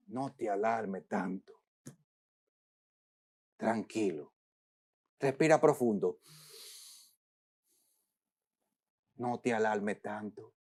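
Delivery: preacher hushing the anxious into calm; hushed calming. Pretend. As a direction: breathe it out gently, nearly silent.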